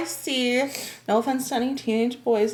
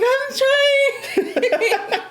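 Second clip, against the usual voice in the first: high, laughing mock wail; flustered customer; 12 semitones higher than usual. Shopper bagging while everyone waits, mock flustered. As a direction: plead helplessly, playing it up.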